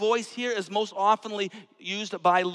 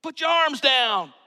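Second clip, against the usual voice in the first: higher and louder, scolding; mock scolding; 7 semitones higher, 9 dB louder than usual. An imagined onlooker scolding the Samaritan, loud and mock stern. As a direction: tell him off in front of everyone.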